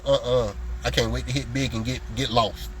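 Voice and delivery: mock dismissive. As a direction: play the ungrateful client waving him away.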